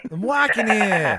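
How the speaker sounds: loud slow New York drawl; hammed-up Staten Island shout; Staten Island accent. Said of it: "Staten Island?".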